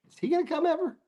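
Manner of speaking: squeaky high teasing voice; high mock voice. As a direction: pitch it way up to mock. Mocking guest's excuse.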